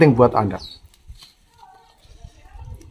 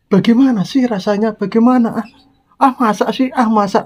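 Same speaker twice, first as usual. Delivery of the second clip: disbelieving listener; 7 semitones higher than usual. Retold or imitated. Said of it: "ya mereka hanya".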